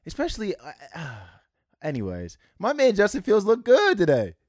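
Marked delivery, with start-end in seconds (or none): 2.6-4.3 loud, high-pitched gushing over the quarterback; excited hype. Real, not acted. Fan praising his quarterback's game, excited hype. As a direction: hype him up loudly, voice pitched way up.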